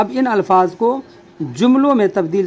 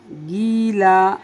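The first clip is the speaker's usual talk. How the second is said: slow, drawn out; much slower than usual. Shown as a demonstration. Teacher modelling गीला for learners, slowly drawn out. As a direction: read गीला slowly with long vowels. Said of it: "क्या हो गया?".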